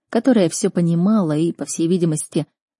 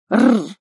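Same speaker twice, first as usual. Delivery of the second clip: short, loud rolled snarl; rattling animal growl; beast growl; 3 semitones higher, 5 dB louder than usual. Acted. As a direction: growl like a big cat.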